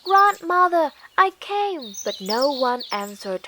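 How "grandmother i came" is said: put-on high, louder girl's call into the house; bright child calling out. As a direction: call for grandma from the doorway.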